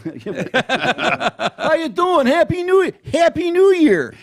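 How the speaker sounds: high, loud Chicago guy. Acted. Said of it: "your Chicago guy voice".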